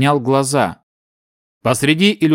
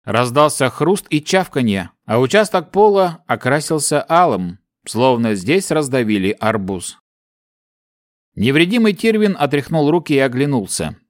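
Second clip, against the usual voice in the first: ordinary delivery, nothing marked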